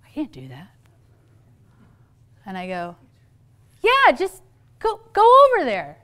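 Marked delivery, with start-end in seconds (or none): 0.0-0.7 put-on low, very soft child's refusal; reluctant child. Child balks at greeting her friend, small reluctant voice. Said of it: "She goes". 3.8-6.0 high, loud parental prodding; insistent urging. Retold or imitated. Mom pushes shy daughter toward friend, mock-insistent. Parent pushing reluctant child.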